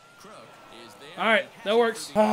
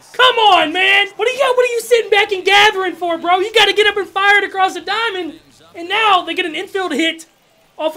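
much higher and louder, furious at the fielder; frustrated yelling; 9 semitones higher, 10 dB louder than usual. Real, not acted. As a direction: scream at the screen in disbelief.

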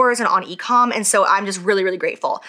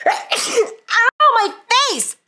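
high, sudden yelp after a cough; comic pained squeak; startled ow; 13 semitones higher, 3 dB louder, much slower than usual. Real, not acted. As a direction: yelp as the powder hits. Powder makes her cough.

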